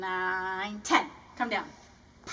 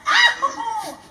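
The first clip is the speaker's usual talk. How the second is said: mock ow; 11 semitones higher, 12 dB louder than usual. Mock hurt by sibling.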